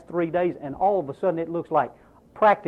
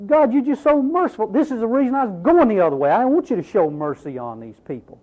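high, loud, sarcastic complaining to God; bitter complaint; 5 semitones higher, 5 dB louder, faster than usual. Retold or imitated. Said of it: "he is so embittered".

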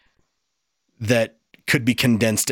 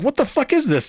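incredulous outburst; 13 semitones higher than usual. Retold or imitated. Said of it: "I was like".

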